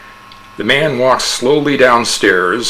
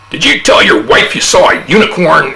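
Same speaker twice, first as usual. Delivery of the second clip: higher, louder questioning officer; stern policeman; 6 semitones higher, 6 dB louder than usual. A narrator's reading. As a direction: demand an answer.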